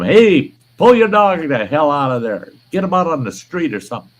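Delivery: loud angry shout at a neighbour; yelling. Retold or imitated. Yelling at the dog walker, angry shout. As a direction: bark it across the yard, fed up. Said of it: "I yelled at him".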